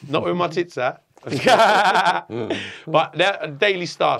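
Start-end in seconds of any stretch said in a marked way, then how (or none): 1.2-2.8 loud laugh at own joke. Real, not acted. Teller joking about page three, laughing. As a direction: burst out laughing.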